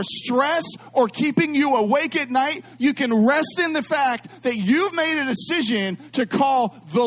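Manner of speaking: sermon rising to a fervent, drawn-out peak; preaching at full force. Acted. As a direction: preach it up to a ringing climax. Preaching builds to climax.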